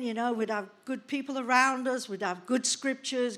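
normal talking voice

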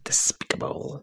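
breathy death-metal whisper. Pretend. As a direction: whisper it menacingly. Echoing the song title.

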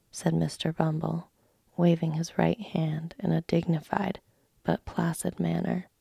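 ordinary delivery, nothing marked

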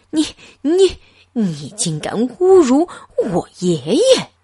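choking on his own outrage; furious shout. A narrator's reading. Gentleman shouting at the clerk, sputtering fury. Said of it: "绅士气得大叫".

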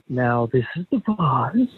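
a hint of a low, somber undertaker; lightly voiced funeral director; Lurch voice. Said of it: "He's got that kind of voice".

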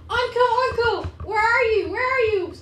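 high, loud searching calls; mayor calling out. Acted. Mayor searching for a missing Anko, calling out high and loud. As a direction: call urgently for someone missing. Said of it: "as you hear the mayor go".

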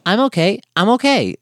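put-on kid falling. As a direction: chirp it like a tumbling kid. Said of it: "you hear him go".

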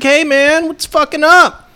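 high, loud, drawn-out greeting; hearty mock greeting. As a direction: imitate a coach greeting you big and loud. Acted greeting from an old coach.

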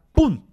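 loud, high "pum" for the impact; imitated crash bang. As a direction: punch out the crash sound like a sudden hit. Choque contra el taxi. (imitando el golpe del choque, fuerte y agudo)

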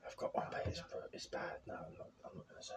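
soft unvoiced whisper. Real, not acted. Backing out of telling a rude joke, whispering. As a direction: whisper it so nobody else hears. Declining to tell a bad joke.

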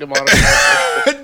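high loud comic shout; hammed-up cry; mock plea. Joking plea to Spotify.

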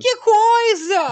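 very high, slow mock outrage; exclamação exagerada. Real, not acted. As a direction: stretch it out in shock. Reação exagerada rindo. (exclamação bem aguda e arrastada, em tom de espanto)

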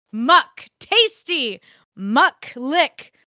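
hammed-up ecstatic read of filthy letter; gleeful reading. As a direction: savour each "muck" like a treat.

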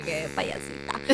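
breathy mocking whisper, voice gone. Imitating the boy who laughed at her, whispered. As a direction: whisper it with scorn. Boy's mocking remark retold.

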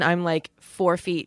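ordinary talk

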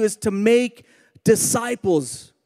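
slow, spaced-out "make disciples"; stress on MAKE. Shown as a demonstration. Preacher telling what God's called us to do, slow stress on "make". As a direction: stretch "make" and let it sink in. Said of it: "think about that word make for a moment".

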